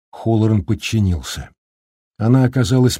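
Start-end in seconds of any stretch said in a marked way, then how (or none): none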